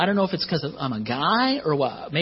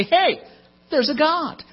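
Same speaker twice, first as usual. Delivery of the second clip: acted sudden realization; 12 semitones higher than usual. Voicing people who look around at creation, high exclaimed realization. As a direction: call it out like a mock discovery. Voices people noticing God exists.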